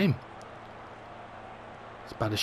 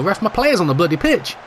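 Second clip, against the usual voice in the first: angry; 9 semitones higher than usual. Real, not acted. Player injured, play goes on.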